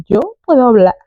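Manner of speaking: put-on "Yo puedo hablar" in a foreign gringo accent; mock gringo Spanish accent. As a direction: speak Spanish like an American learner. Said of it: "tipo como gringo".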